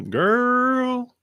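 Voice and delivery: very high, drawn-out sassy call. Acted. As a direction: stretch it out high with playful sass. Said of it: "They are very sassy".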